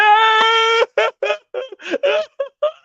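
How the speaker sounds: long, very high, loud goal scream; celebration scream. Real, not acted. Fan watching the team score, ecstatic scream. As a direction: shriek with joy as the goal goes in.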